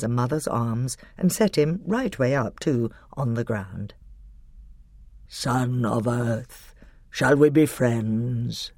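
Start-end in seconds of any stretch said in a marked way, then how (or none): 5.3-8.7 very slow, rumbling lion voice; Aslan. A narrator's reading. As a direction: offer friendship in long, stately words. Aslan offers friendship to dwarf.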